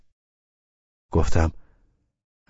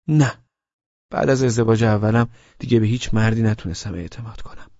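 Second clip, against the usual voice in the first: put-on higher young woman's voice, wary; Laleh's voice; 4 semitones higher, 4 dB louder than usual. A narrator's reading. Laleh explaining to Abbas she can't trust men, higher.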